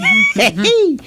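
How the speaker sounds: high burst of laughter. Acted. Teasing her friend about age, laughing. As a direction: laugh hard at her own joke.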